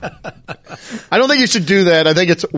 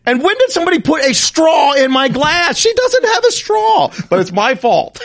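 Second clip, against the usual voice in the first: higher, louder incredulous outburst; exasperated protest; 11 semitones higher, 3 dB louder than usual. Retold or imitated. Son arguing with mom, high exasperated protest. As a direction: argue it's ridiculous, half laughing.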